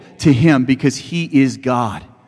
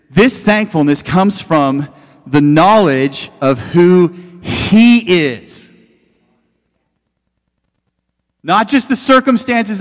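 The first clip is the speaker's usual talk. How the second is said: high, loud, slow impassioned preaching; loud rising sermon over music; preaching at full force; 7 semitones higher, 4 dB louder, slower than usual. Acted. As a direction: build to a fervent climax.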